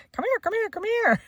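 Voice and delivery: high urgent call across the yard; retold shout to son to come quick. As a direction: call him over, pitch way up. Calling son outside urgently.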